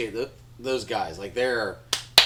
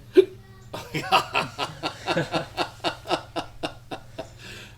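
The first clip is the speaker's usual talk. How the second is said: breathy laugh; 4 semitones higher than usual. Real, not acted. Laughs at the hiccups.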